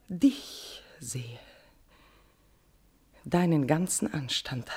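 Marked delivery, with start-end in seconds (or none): 0.1-1.4 unvoiced, lower whisper; a breathless whispered admission; whispered reveal. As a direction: let the confession escape in a whisper. Queen revealing she means him.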